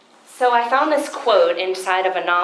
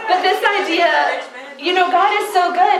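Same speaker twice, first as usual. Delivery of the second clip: excited praise; 10 semitones higher, 7 dB louder than usual. Real, not acted. Exclaims God's goodness.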